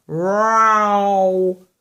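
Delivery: drawn-out yowling cat; a cat wailing from a branch. Acted. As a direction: yowl it like a stuck cat. Cat yowl sound in story.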